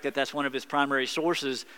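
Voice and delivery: usual speaking voice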